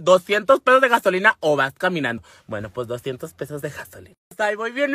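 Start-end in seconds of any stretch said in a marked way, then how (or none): 0.0-2.2 insistent parental demand; firm ultimatum. Retold or imitated. Mother setting terms for lending the truck, firm ultimatum. Acted mother lays down her condition. 2.5-4.1 low, much softer giving-in; resigned agreement. Retold or imitated. Daughter accepting mother's gas-money terms, low and resigned.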